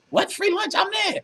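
very high, eager mock surprise; hammed-up excitement. Big man acting out falling for free lunch, hammed-up excitement. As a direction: jump at the offer in a squeaky-high pitch. Acts out eager taker of bait.

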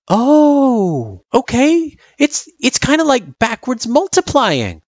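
put-on high kid voice, long dawning "oh"; light up as it clicks; dawning realisation. Frisk finally getting division, delighted realisation.

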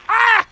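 very loud, high-pitched shriek; short excited scream; high yelp. Real, not acted. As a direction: let out a sudden piercing cry. Outburst admiring the blood beast.

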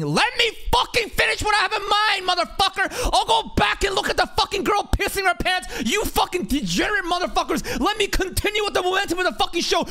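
an angry streamer screaming at his chat; furious rant. Real, not acted. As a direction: tear into the viewers, yelling. Rants at chat about piss.

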